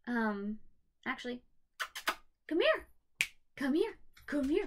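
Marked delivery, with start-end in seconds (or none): 2.5-4.6 high, loud call to a dog across the room. Real, not acted. Owner calling her dog to come up on the bed, loud and high. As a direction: coax him over with a raised voice.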